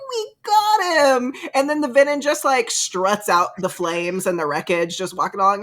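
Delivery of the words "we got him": high, loud, slow mock cheer; mock triumph. Violet after torching the tower, mock triumphant cheer. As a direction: crow it like a victory. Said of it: "she's like".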